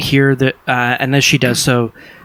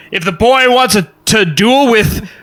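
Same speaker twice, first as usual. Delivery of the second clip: higher, raised, hearty voice; heckling onlooker; 9 semitones higher, 4 dB louder than usual. Acted. Jupiter speaks up for the squire's duel, loud brash urging. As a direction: call it out with brash relish. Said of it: "Jupiter Jones speaks up".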